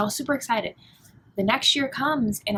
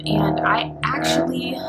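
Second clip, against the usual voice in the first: choking up mid-sentence, slow and much lower; voice breaking; 5 dB louder, slower than usual. Real, not acted. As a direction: voice cracks as the tears come. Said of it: "I'm sorry".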